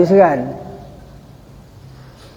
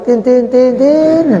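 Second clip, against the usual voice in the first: high repeated "tin" climbing higher; long horn imitation; 7 semitones higher, slower than usual. Preacher mocks the longer horn greeting, rising. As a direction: honk a long rising beep. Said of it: "Kalau dia mau panjang".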